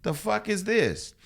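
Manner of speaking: high, slow, stunned disbelief; shocked disbelief. Retold or imitated. Pulled over by police, stunned high disbelief. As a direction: let it rise in sheer shock.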